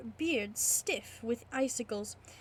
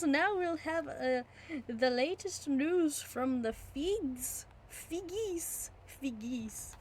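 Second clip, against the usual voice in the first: higher, lightly voiced landlord; hearty old innkeeper calling out; 5 semitones higher than usual. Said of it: "The landlord cried".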